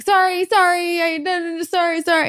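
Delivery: high, loud flustered sorrys; flustered apologizing. Retold or imitated. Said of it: "'cause I would always be like".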